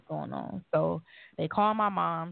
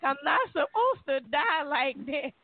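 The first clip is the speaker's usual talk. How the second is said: tearful plea, much higher; crying plea; 8 semitones higher than usual. Retold or imitated. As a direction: beg through tears, voice cracking upward. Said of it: "And I'm just crying".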